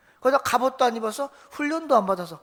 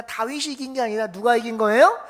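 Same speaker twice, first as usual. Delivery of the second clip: usual speaking voice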